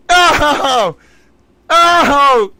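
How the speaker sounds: stunned outcry at the news; excited gasp. Real, not acted. Streamer reacting to shocking trial news, loud excited gasps.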